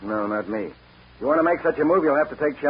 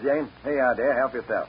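normal talking voice

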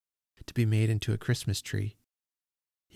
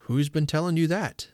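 questioning father; 6 semitones higher, 4 dB louder than usual. A narrator's reading. Father questions child's news.

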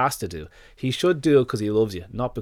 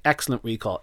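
normal talking voice

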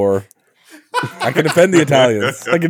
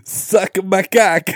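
mock Italian; 7 semitones higher, much slower than usual. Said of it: "they're like".

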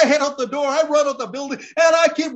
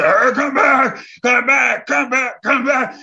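loud slow yell to a fleeing man; angry wrestler yelling; 5 dB louder, slower than usual. Retold or imitated. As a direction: bark 'come back' as he reaches under the ring. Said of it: "But he's reaching".

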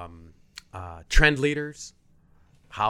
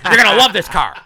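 loud, high, pushy car salesman patter; car salesman pitch; 9 semitones higher, 10 dB louder than usual. Retold or imitated. Imitating a car salesman pushing a customer, loud and high. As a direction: sell it hard like a lot hustler. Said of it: "everybody trying to get you to drink something talks like this".

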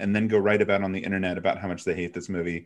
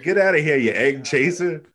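mock bigot; 6 semitones higher, 5 dB louder than usual. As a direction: sneer it like an old bigot. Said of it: "I thought that sounded like an obscure racism".

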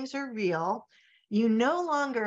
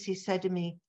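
ordinary delivery

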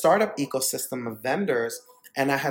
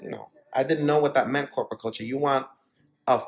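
normal talking voice

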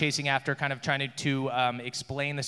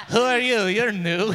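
guard voice; 6 semitones higher, 7 dB louder than usual. Acted. NPC questions prisoner.

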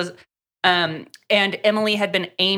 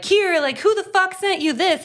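higher-pitched, jealous demand; mock super jealous; 10 semitones higher than usual. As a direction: imitate a jealous guy demanding an answer. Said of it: "is, like, super jealous".

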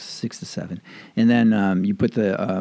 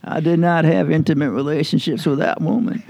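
higher, deliberate presidential denial; higher, put-on statesman voice, a bit louder; Bill Clinton impression; 6 semitones higher, 4 dB louder than usual. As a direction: do a hammed-up famous denial. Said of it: "I sound like Bill Clinton there".

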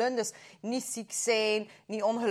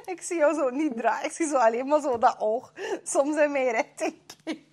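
breaking character giggling; 4 semitones higher than usual. Real, not acted. Teasing his frozen pose, giggling.

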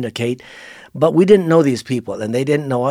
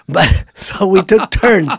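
higher, slow, laughing as the words come; 5 semitones higher, 3 dB louder, slower than usual. Real, not acted. Missionary telling listeners about sucking out venom, laughing. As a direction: laugh your way through it.

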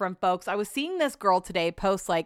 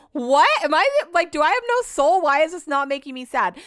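high, loud retelling of your own bafflement; mock-outraged self-questioning, pitched up; baffled disbelief; 7 semitones higher, 5 dB louder than usual. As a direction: act out an exasperated "am I heartless?" reaction. Acts out own baffled reaction to friends.

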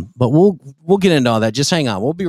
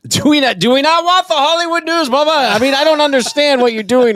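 exasperated; 10 semitones higher than usual. Real, not acted. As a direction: throw up your hands at him. Complaining loudly to Bubba.

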